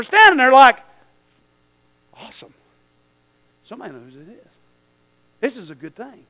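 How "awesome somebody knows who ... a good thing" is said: very soft, low aside, as if awestruck parents; barely voiced, quietly marveling; hushed awe. Parents standing watching, awed.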